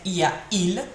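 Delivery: "il y a il" said "ee-a, eel", with a catch before "il"; glottal stop. Shown as a demonstration. Teacher showing the disliked sound, glottal stop before the last "il". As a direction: break between "a" and "il" with a glottal stop. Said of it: "that kind of uh sound in it".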